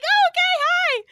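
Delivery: very high-pitched, squealed reply; exaggerated high-voiced hello; squeaky flustered greeting. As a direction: imitate yourself greeting someone in a squeak. Her startled reply to greeting, retold.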